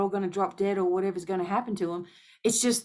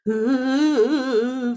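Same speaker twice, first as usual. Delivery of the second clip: imitated gasp; 4 semitones higher, 5 dB louder than usual. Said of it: "they're just like".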